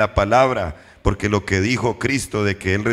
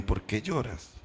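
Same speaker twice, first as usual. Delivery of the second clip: much softer, gentle fall on lloras; tender question; 9 dB quieter than usual. Retold or imitated. Angels lovingly asking weeping Mary, soft tender question. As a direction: ask it lovingly, almost hushed. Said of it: "Ellos con amor le dijeron".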